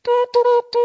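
very high, loud shouted calls; shouting out. Shown as a demonstration. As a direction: cry out like a ram's horn blast. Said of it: "Jeg er klar til å rope". (høye rop)

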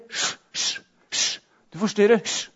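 soft whispered shush; hushing whisper. Retold or imitated. Crowd telling the beggar to be quiet, whispered hush.

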